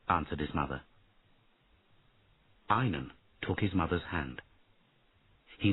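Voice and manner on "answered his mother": usual speaking voice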